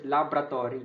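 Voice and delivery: laboratory as LAB-ra-tory, dropping the first o. Shown as a demonstration. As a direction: say laboratory quickly without its first o. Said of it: "how do we pronounce this?".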